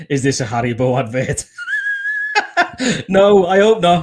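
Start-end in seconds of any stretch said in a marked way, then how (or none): 1.5-3.0 real laugh squeaking up high; squealing laugh at the joke. Real, not acted. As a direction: burst into a high giggle. Laughing at the Haribo advert joke.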